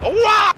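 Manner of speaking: high, loud cartoon "Wah!"; Toad-like yelp. Player re-enacting a shot at a rider, high cartoon yelp. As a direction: yelp it like a squeaky Mario character. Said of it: "sounded like fucking Toad from Mario".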